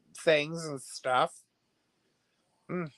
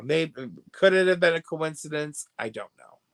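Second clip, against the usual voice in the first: ordinary delivery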